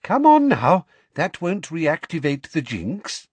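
pushy coaxing Umbridge. Acted.

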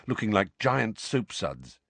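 plain reading voice

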